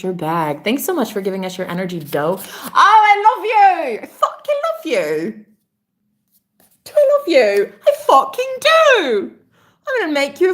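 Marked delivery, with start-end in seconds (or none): 2.4-10.5 laughing through tears, slow and choked up; overcome, half laughing, half crying; tearful love. Real, not acted. Streamer crying with love to a generous donor, tearful and laughing. Overwhelmed thanking a donor live.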